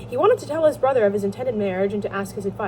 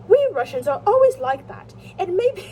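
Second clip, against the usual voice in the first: higher put-on British accent; 10 semitones higher, 5 dB louder than usual. Koshnev complains about Russians, in an attempted British accent. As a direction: play the Russian as an Englishman. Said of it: "I give them a British accent".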